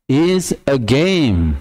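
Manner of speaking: "is a game" very slow; slow demo. Shown as a demonstration. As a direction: stretch "is a game" out. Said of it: "Tuh, ada e-nya". ("is a game" diucapkan sangat pelan)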